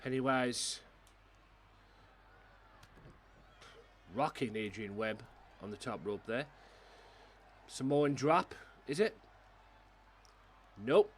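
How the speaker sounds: live wrestling play-by-play; wrestling commentator. Pretend. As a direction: call each move as it lands. Calls the game moves live.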